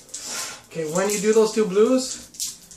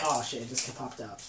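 ordinary talk, nothing marked

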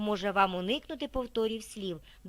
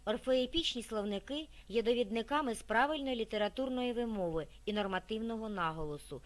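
ordinary delivery

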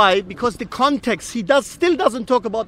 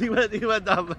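laughing through the words; 6 dB quieter than usual. Real, not acted. Debater ridiculing his opponent's rocket-like leap, laughing. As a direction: break into amused laughter while talking.

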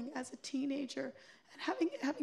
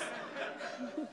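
soft breathy laugh after the line; a quiet breathy laugh; 3 semitones lower, 5 dB quieter than usual. Real, not acted. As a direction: silent shaking laugh.

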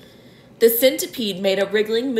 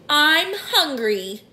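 slow, raised voice of a hungry spider; spider announcing; 6 semitones higher, slower than usual. A narrator's reading. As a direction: announce the hunger, drawing it out. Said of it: "the spider announced suddenly".

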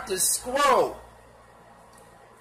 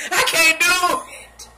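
can't take it; 9 semitones higher, 7 dB louder, slower than usual. Real, not acted. Laughing disbelief.

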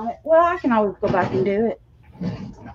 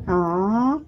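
ordinary talk

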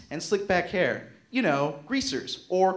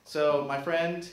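ordinary talk, nothing marked

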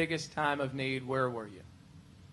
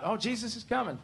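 higher, put-on voice; Martha's imagined reaction, pitched up; mock excitement; 6 semitones higher, 4 dB quieter than usual. Preacher imagines Martha hearing Jesus arrives, mock excitement.

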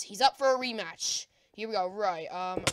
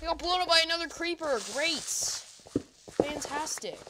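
ordinary talk

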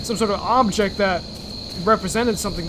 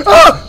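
high, very loud shriek; startled scream; 13 semitones higher, 13 dB louder than usual. Real, not acted. Player caught by the monster, startled scream. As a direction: scream in sudden fright. Jump scare in game.

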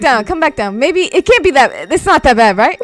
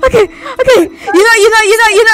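panicked crying; 11 semitones higher than usual. Acted. Scared, cornered by monster.

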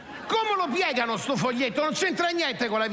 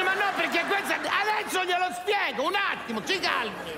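doctor yelling; 9 semitones higher than usual. Acted. Doctor still yelling to calm.

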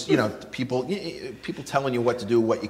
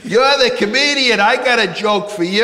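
higher and louder, eager fan cornering the comic; pushy cruise fan; 10 semitones higher, 6 dB louder than usual. Retold or imitated. Cruise passenger pestering the comedian, loud and eager. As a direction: play the passenger who won't leave him alone.

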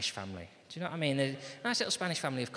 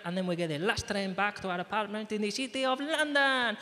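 higher-pitched Spanish dad; Spanish accent; 7 semitones higher than usual. Acted. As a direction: lay out the holiday plan in a Spanish accent. Spanish dad describes the holiday plan.

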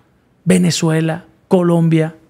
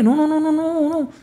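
high, quick string of mock refusals; mentor's no; 10 semitones higher than usual. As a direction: wave off the protest like the teacher. (negación imitada del maestro, voz muy aguda)

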